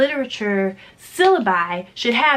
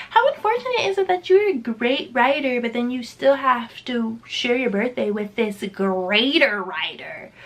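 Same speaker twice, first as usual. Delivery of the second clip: mock-pitying joke; 2 semitones higher than usual. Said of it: "I always used to joke".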